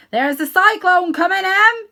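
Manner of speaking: loud, high urgent call. Acted. Uncle Henry calls to his wife about the cyclone, loud. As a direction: shout the warning across the yard. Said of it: "he called to his wife".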